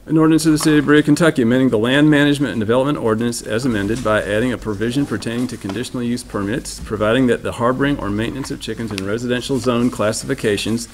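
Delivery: loud, formal read of legal text; formal ordinance reading. Real, not acted. Reading the chicken ordinance aloud to the board, loud and formal.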